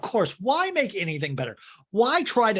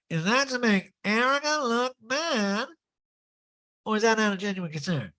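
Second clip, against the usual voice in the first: mock Erica, a touch higher; mic drop line; 8 semitones higher than usual. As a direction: lean into it as a killer line. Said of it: "this next line, which was a fully mic drop line".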